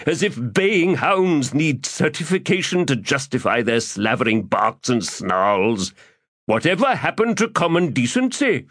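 florid, theatrical gentleman rogue; lofty, self-pleased orator; pompous Crupper voice. Acted.

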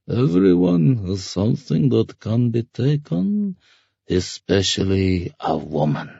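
slow, drawn-out scarecrow voice, swooping high then dropping; leering ferryman. Acted. Ferryman hinting at payment from a woman, leering drawl. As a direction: drawl it with sly menace. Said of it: "the ferryman grunted".

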